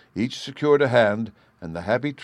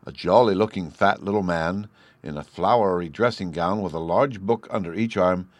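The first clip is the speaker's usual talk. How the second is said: ordinary delivery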